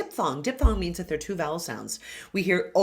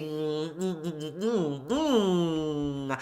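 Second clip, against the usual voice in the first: soft hummed mm with a loose jaw; soft humming; 2 semitones lower, 4 dB quieter, much slower than usual. Shown as a demonstration. Coach hums to show the nasal ng resonance. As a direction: hum quietly, jaw unhinged. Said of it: "Notice how I'm unhinged in the jaw".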